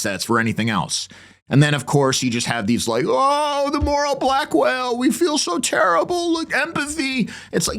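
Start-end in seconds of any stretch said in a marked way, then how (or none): 3.1-7.3 very high, slowed mock lament; mocking falsetto piety. Host mocks church leaders' show of empathy, high simpering falsetto. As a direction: parody a simpering bleeding heart. Mocks clergy's moral posturing.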